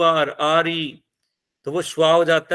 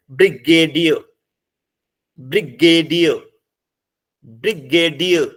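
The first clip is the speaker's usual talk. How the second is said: Brigadier slowly, punching the final dear; careful syllables in brigadier, the end stressed; stress on last syllable; 4 dB louder than usual. Shown as a demonstration. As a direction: land firmly on dear in brigadier. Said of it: "last पे हमने stress लगाई".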